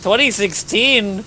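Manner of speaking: overplayed disbelief at "Twenty sixteen?"; exaggerated incredulous. Real, not acted. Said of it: "What's with that twenty sixteen voice?".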